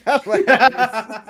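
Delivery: slow, laughing through it; amused payoff, laughing; laughing punchline. Real, not acted. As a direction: let the laugh carry the punchline. Laughing at his own joke.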